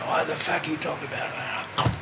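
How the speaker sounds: soft, whispery rasp. Retold or imitated. Musician just woken by the caller, imitated rasp. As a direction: imitate a sleepy hoarse mumble. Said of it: "And so then he was".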